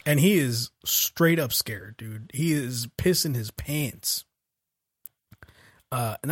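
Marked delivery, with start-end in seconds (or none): none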